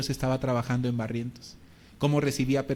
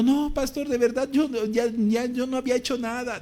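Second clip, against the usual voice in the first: much higher, pleading, a touch of tears; imitated crying; 8 semitones higher than usual. Israel weeping on the phone to the pastor, imitated tearful plea. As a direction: mimic a man pleading while crying. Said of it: "Y me decía llorando".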